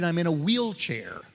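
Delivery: usual speaking voice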